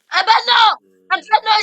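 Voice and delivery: shouting. Real, not acted. Yelling in game.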